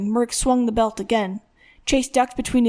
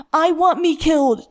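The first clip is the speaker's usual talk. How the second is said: high, raised cry of distress; screamed; 6 semitones higher, 4 dB louder than usual. A narrator's reading. Beaten son answers his father, screaming. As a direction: scream it back in desperation. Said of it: "Chase screamed".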